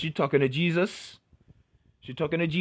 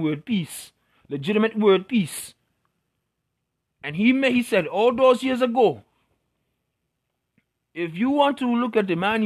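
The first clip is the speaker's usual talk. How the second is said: put-on talks it out in a higher, louder West Indian accent; 4 semitones higher, 4 dB louder than usual. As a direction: lean fully into a West Indian lilt while ranting. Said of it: "pardon the accent change".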